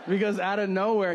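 ordinary talk

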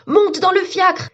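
high, stretched urgent shout; alarmed high-pitched command; crying out. A narrator's reading. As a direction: yell to hurry a friend aboard. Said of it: "s'écria Kenji".

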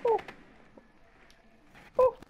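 normal talking voice

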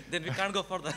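chuckling while he gives up the point; laughing. Real, not acted. Laughing as the debater concedes they are stuck. Laughs after the short answer.

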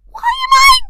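very high put-on voice building to a shriek; squeaky falsetto. Acting a line in falsetto.

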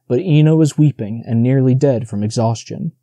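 ordinary delivery

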